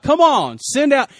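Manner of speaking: high, loud mocking challenge; taunting yell. Goliath taunting the army of Israel, yelling mockingly. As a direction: yell it across as a jeering champion. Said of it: "He's yelling stuff at them. He's making fun of them".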